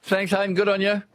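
high, lifted radio sign-off; upbeat host thanks; cheery farewell. Real, not acted. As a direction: send him off beaming.

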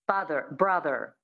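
American 'father, brother', final Rs pronounced; American accent. Shown as a demonstration. Coach modelling words for a student to repeat, American accent. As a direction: sound the R endings the American way. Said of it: "So that's the American or".

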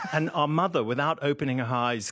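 ordinary talk, nothing marked

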